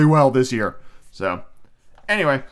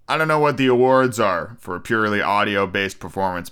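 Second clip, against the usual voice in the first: ordinary talk, nothing marked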